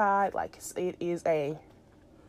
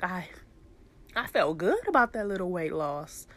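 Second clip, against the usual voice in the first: ordinary talk, nothing marked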